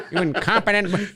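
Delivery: high-pitched villain scolding, breaking into laughter; cartoon villain voice. Fan imitates a cartoon villain's insult, high laughing villain voice.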